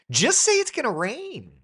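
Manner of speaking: high, loud exasperation; insist, fed up; exasperated. Real, not acted. Telling interns to speak plainly, loud and exasperated.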